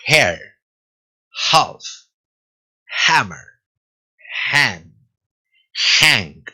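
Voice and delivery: each word from "Hair" to "Hang" opened with breath; word-by-word H drill, "hammer", "hand"; "hand" and "half" with audible h friction; jota-like English H. Shown as a demonstration. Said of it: "suena como una J solapada con".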